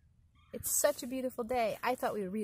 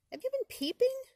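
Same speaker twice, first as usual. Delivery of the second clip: much higher and louder question; shouted question; 5 dB louder than usual. Acted. Possum shouting a question at the muskrat in the marsh. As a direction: call it out over a racket. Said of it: "shouted Possum".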